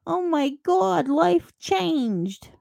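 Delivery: high, drawn-out fake horror; mock overreaction. Mocking easily offended people who panic at change, exaggerated dismay. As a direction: wail it as an over-the-top crisis. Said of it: "to the nth degree of".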